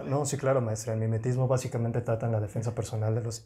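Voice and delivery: imitación de alumno aplicado, más bajo y contenido; fingiendo compostura. Retold or imitated. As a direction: recite it calmly to the teacher, playing innocent. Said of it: "por arriba yo estaba". (fingiendo compostura ante la maestra)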